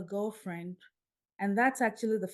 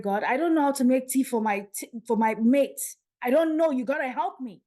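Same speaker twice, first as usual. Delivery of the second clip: louder, higher pleading; retold desperate plea; 4 semitones higher, 7 dB louder than usual. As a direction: beg for help in rising protest. Pleading with God after dream.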